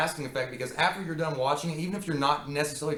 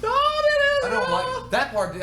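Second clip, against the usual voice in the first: very high, very slow singsong; mock creepy singsong; 6 dB louder, much slower than usual. Mock creepy singsong recalling the film's song. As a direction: drawl it like an eerie song.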